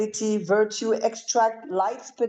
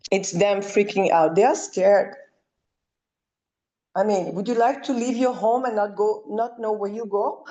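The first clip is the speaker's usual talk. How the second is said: ordinary talk, nothing marked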